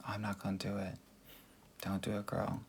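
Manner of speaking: quiet. Shown as a demonstration. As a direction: drop the voice and say it softly.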